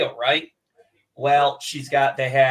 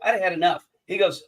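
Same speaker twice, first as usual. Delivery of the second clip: ordinary delivery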